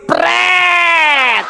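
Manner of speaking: sharp high scoffing "Pret!" thrown at the name; mocking pret. Preacher scoffs at the borrowed church name, mocking. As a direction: scoff it out like a raspberry.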